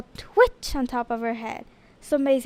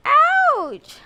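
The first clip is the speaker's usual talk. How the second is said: a much higher, louder yelp of pain; hollering ouch; 14 semitones higher, 5 dB louder than usual. Acted. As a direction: yelp it as the stalk is pulled. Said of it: "hollered Maisie".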